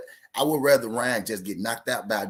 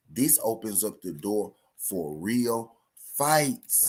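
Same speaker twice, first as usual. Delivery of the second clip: said again slow; much slower than usual. Shown as a demonstration. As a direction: lay it out word by word. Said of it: "I'ma say it again slow".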